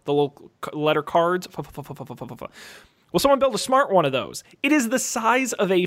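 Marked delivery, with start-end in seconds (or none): 1.5-2.5 soft flipping sound. Retold or imitated. Imitating flipboard, softly.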